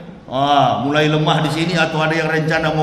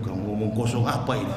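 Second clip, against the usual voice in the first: muttered retort; 10 semitones lower, 12 dB quieter, faster than usual. Retold or imitated. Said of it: "Saya bilang".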